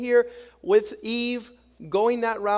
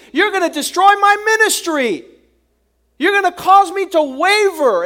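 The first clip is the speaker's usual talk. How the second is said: a preacher's thundering rebuke; Jesus rebuking; 8 semitones higher, 11 dB louder than usual. Acted. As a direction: cast the devil off with full force. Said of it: "he says".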